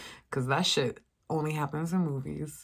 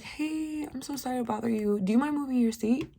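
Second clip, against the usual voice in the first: much higher, quick, sugary-polite request; overly nice voice, higher and quick; super polite; 9 semitones higher than usual. Retold or imitated. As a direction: ask sweetly so nobody could take offence. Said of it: "super duper nice to this lady".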